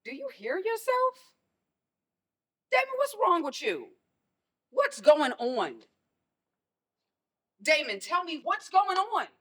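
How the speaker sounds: a worried mother demanding the truth; alarmed pleading. Acted. Mother alarmed, pressing her son.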